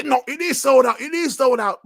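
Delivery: high, insistent mocking fanboy voice; mock console fanboy. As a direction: mimic a stubborn fan who insists it's sold out. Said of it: "they wanna say".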